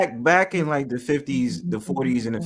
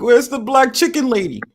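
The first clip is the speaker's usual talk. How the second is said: high, loud mock greeting; mock call-out; 13 semitones higher, 5 dB louder than usual. Teasing host calling out the chicken lady. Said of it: "I'll be like".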